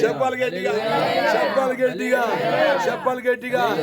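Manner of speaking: preacher demanding a loud amen; urging the crowd. Real, not acted.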